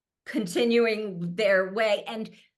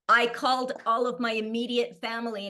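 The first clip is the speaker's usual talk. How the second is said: ordinary talk, nothing marked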